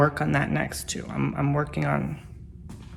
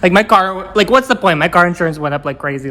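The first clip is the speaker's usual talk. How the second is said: exasperated rant; 12 dB louder than usual. Real, not acted. Ranting about car insurance.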